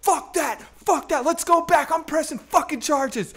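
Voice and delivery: high, heated indignant rant; so angry. Retold or imitated. Punched teen urging his friend to go back, angry. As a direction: reenact a furious demand to turn back. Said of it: "I'm now so angry".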